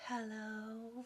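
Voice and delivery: soft, sleepy, just-woke-up hello; sleepy poodle voice. Retold or imitated. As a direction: imitate a woman answering half asleep, softly. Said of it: "sleepy, I just woke up, soft voice".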